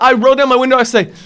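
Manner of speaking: usual speaking voice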